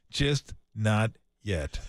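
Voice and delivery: low, drawn-out words with gaps between; slow emphatic repeat. Acted. Old-timer telling the younger man to wait his turn, slow and emphatic. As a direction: spell it out slowly, word by word.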